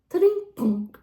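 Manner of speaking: loud mock crash noise; falling-off sound effect. Rider mimics falling off horse, loud crash sound. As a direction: make the sound of tumbling off a horse.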